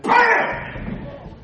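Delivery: sudden loud high burst; explosive "bam" for a fish strike; startling loud sound effect; imitated impact.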